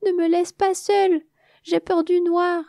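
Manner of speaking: high, tearful, slowing down; tearful plea. Acted. The little bee alone in the dark, tearfully pleading. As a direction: plead on the edge of tears. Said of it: "avant de fondre en larmes".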